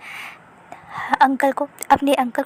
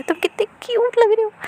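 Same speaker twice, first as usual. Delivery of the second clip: much higher squeaky gush; high gushing coo; 9 semitones higher than usual. A narrator's reading. Teen squealing at the adorable baby, high coo.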